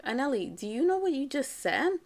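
high, incredulous motherly challenge; acted-out indignant question; retold confrontation. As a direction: question her as if you can't believe it. Mother confronts daughter over insult.

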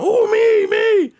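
very high, loud mock eagerness; high-pitched excited imitation; eager volunteer. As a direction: shout it like an over-keen old driver. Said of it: "who wants to go out of town?".

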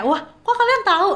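high-pitched, amazed reaction being retold; imitated surprise. Podcaster retells an audience's reaction to being well researched, surprised. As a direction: mimic someone caught off guard, pitch jumping up.

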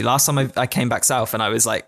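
ordinary talk, nothing marked

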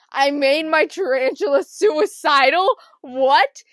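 loud, hammed-up disbelief; incredulous shout. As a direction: shout it like a horrified realisation. Acts out horrified realisation.

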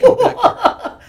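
loud high shout. Retold or imitated. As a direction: yell him back. Calling friend back from alley.

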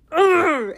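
sudden guttural ugh; exasperated "ugh". Real, not acted. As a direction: groan out loud, lost for words. At a loss over the romance.

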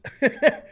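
high, loud chuckle; amused laugh. Real, not acted. Host rooting against the Pats, high amused laugh. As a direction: burst into a short high laugh.

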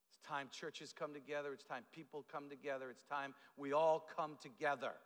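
usual speaking voice

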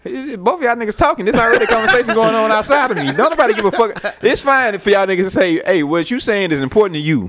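high-pitched comic outrage; mock scolding. Host telling off two side talkers, mock scolding. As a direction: scold them in a strained, way-up squeal.

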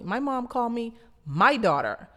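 ordinary talk, nothing marked

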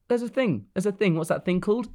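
high-pitched mock bewilderment; puzzled Barbie. Barbie notices a thing called death, mock puzzled. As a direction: pitch it up like a doll puzzling aloud. Acting Barbie's first troubling thought.

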